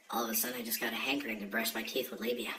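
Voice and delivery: fast, soft whispered aside. Acted. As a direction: slip it out as a quick whisper.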